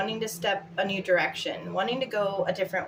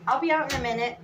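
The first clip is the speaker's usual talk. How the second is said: calling out; 4 semitones higher, 4 dB louder, faster than usual. Real, not acted. Sorry, that was my daughter.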